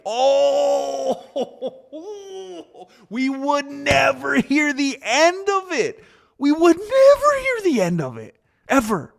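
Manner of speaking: high, drawn-out wail of mock outrage; mock outcry. Imagining rival fans' uproar, high mock outcry. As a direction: crow it high, stretching out the big words.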